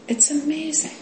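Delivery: high, soft mock amazement; a hushed, high gasp of wonder; mock awe. Shown as a demonstration. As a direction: float "amazing" up in wonder.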